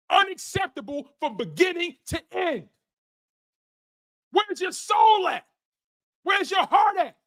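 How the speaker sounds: high shouted outrage; furious rant. Real, not acted. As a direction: vent the fury at the team. Raging over the awful game.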